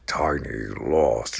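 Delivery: ordinary delivery, nothing marked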